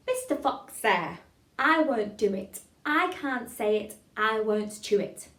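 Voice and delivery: slow, loud, every word articulated; tongue-twister recitation. Shown as a demonstration. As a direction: chant it deliberately for a class to follow. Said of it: "Let's try it together".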